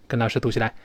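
ordinary talk

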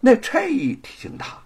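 usual speaking voice

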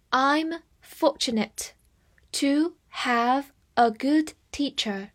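slow, word by word, with pauses between; slow read-aloud. Shown as a demonstration. Teacher models the example sentence for learners, slow reading. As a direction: read it slowly for learners to follow. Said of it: "我们慢慢来读".

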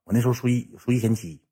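low, soft, halting voice; imitated sheepish plea. Yuntao sidling up to his big brother about the bill, imitated sheepish plea. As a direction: plead small and quiet, almost under the breath. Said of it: "云涛上我跟前这么跟我说句话".

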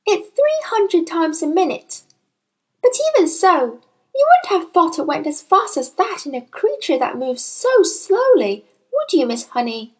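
put-on high-pitched, curious girl; high child voice, wondering; eager child Matilda. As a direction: chatter keenly like an interested child.

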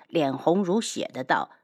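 ordinary delivery, nothing marked

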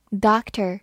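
"doctor" with American open "ah" and final r; American pronunciation. Shown as a demonstration. Teacher showing learners the US variant, American pronunciation of "doctor". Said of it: "美式发音".

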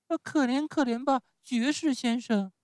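put-on very high puppet-child voice; pleading Pinocchio. Pinocchio begs the showman for pity, high pleading. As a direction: beg for mercy in a piping voice.

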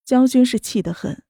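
usual speaking voice

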